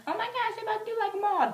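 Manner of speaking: high, loud fangirl squeal; reenacted fan excitement. Fan realizing her favorite song is next, squealing.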